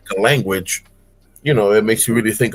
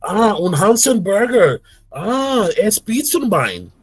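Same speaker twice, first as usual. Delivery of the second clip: much higher, hammed-up mock German; fake German; 9 semitones higher, slower than usual. Two friends faking German in public, hammed-up. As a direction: ham up nonsense German, slowing at the end. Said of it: "making it sound like German".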